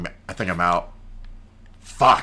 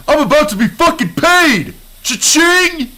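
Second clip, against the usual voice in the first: high, loud gleeful yell; excited shout; 5 dB louder than usual. Acted. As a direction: cheer like you hit the jackpot. Celebrates getting paid.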